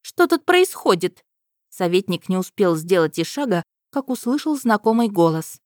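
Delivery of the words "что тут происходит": high, slow indignant princess. A narrator's reading. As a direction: demand it like a haughty princess.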